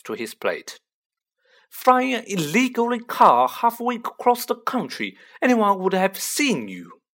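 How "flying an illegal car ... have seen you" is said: high, louder motherly reproach; scolding mother. A narrator's reading. Mother scolding boys for flying the car, high and exasperated. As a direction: scold them in a raised, indignant voice.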